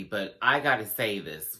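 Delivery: ordinary talk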